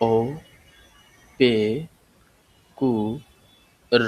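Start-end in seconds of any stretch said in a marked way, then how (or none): 3.9-4.0 র made at the back of the throat; guttural German র; German throaty R. Shown as a demonstration. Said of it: "এটা গলার ভিতর থেকে একটু উচ্চারণ করতে হবে".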